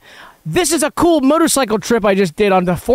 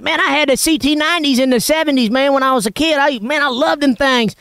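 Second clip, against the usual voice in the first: Southern neighbor voice; 4 semitones higher than usual. Retold or imitated. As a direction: imitate the excited Southern man in a rural twang. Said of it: "He's like".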